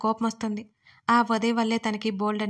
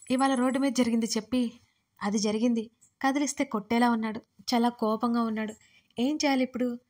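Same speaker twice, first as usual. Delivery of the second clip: plain reading voice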